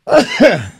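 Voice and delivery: loud sudden sneeze; real sneeze. Real, not acted. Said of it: "I'm about to sneeze".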